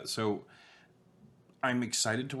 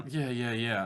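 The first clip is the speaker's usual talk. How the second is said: usual speaking voice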